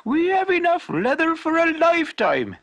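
shoemaker's wife rejoicing over their new fortune; happy wife. A narrator's reading. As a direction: burst out with delight. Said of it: "said the shoemaker's happy wife".

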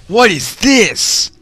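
ordinary talk

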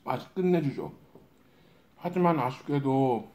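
speaking between bites; talking with food in mouth; mouth full, chewing. Real, not acted. Seen 0: Ate noodles just before; mouth rounded, cheeks full, chewing while speaking.

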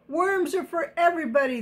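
high, fast protest; crying out. Acted. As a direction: blurt it out indignantly. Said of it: "they cried".

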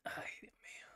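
very soft real whisper; hushed, voiceless oh-no; whispered dismay. Real, not acted. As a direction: breathe it out under your breath. Muttered dismay during the game.

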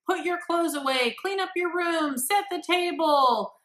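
high, loud barked commands; barking orders. Shown as a demonstration. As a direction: bark orders at a child. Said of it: "bark orders at you".

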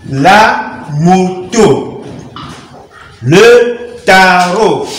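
very slow, drawn-out reading lesson delivery; syllable by syllable. Shown as a demonstration. Teacher training learners to read words, slowly syllabified. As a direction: read it out with each syllable stretched and separate.

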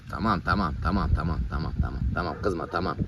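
low, soft repeated reassurance; calm an upset puppy in a low voice; soothing. Real, not acted. Calming an angry puppy. (alçak sesle yatıştırma)

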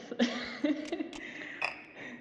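light, breathy chuckle after speaking; quiet, unvoiced chuckling; short laugh. Real, not acted. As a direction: let out a soft little laugh. Laughs after thanking the host.

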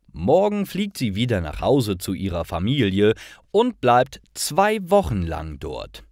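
ordinary talk

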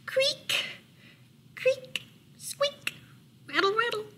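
very high squeaky bed sounds; creaking bed noises. A narrator's reading. Mouse's bed as he hops in, high creaking and rattling. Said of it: "went Mouse's bed as he hopped in".

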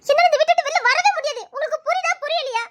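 desperate cry that she cannot leave; desperate outburst. Acted. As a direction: raise your voice, near breaking. Refusing, voice rising in despair.

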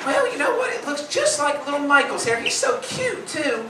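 high, sugary coworker voice; high mock cooing hairdresser; sweet coworker. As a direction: coo it sweetly. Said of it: "Judy goes".

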